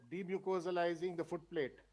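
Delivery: "demucosalizing the foot plate" said very faint, far from the mic; "demucosalizing" murmured barely audibly; barely audible. Real, not acted. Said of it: "your voice is very low".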